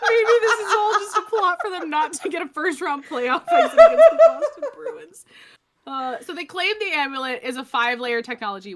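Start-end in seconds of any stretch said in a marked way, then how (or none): 0.1-5.2 laughing. Real, not acted. Mocking hockey team, laughing.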